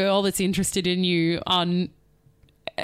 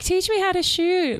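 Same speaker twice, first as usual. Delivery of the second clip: higher mock girly plea; girly gamer voice; 10 semitones higher than usual. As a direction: play a coy gamer girl. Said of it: "He's like".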